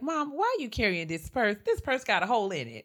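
exasperated daughter scolding; fussing. Retold or imitated. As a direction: fuss at your mother, building in force. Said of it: "like, fussing all the time".

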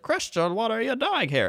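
high-pitched imitated startled woman; mock surprise. Voices startled woman in retold film.

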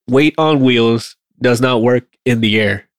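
slow, deliberate word-by-word repeat. Shown as a demonstration. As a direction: spell it out slowly so it sinks in. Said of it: "say it slower and then hear yourself".

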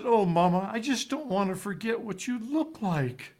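put-on a small boy who doesn't want to forget; little boy to mom. Nine-year-old boy tells his mother goodbye, tender.

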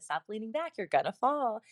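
high, light nagging mom voice; a playful impression of a worried mom; mock mom warning. Imitating moms warning the boy about tipping back.

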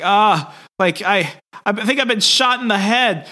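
high-pitched whine of someone hurt; mock distress. Peter in Wolverine's body, shot in the head, mock distress. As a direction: play up dismay at an injury. Acted complaint of being shot.

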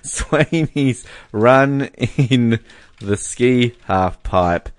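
spaced out word by word while typing; slow, halting read-back as it is written down; slow dictation. Real, not acted. Co-host typing out a fan message, slow dictation.

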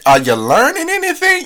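high, fast nosy mom question; mother voice. Retold or imitated. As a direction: pop in like a prying mom. Mother steps in and asks.

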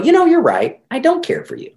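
high, put-on voice saying what no spouse would say; mock confession. Therapist mocking an imagined spouse's admission, high and put-on. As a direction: squeak it out like a spouse caving in. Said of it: "They're not gonna go".

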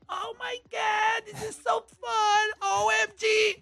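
hammed-up squeal of a girl out partying; mock party girl. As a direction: camp up a giddy girl at a party. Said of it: "la jeva que dice".

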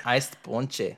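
"Iced ponche" said as "eyest pawn-chee"; playful odd pronunciation. Acted. Amusing co-host with the drink's name, playful odd pronunciation. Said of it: "The way you said it".

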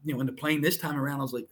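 normal talking voice

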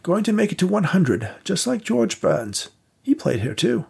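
put-on an old vaudeville house keeper showing guests around; old theatre manager. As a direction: play the elderly manager, courteous and unhurried. Victor boasts about his age.

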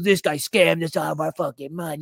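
whiny put-on griping voice; jeering imitation of a complaint; mocking complainer. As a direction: mimic a ranting reviewer trailing into nagging noise. Imagined angry online review, mocked.

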